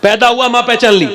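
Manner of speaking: usual speaking voice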